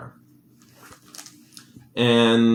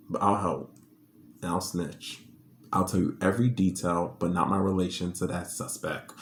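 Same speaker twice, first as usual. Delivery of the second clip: much softer, confiding aside; hushed aside; 3 semitones lower, 11 dB quieter than usual. Real, not acted. The speaker confiding what she'd tell the cops, softly hushed. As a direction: drop low like sharing a secret.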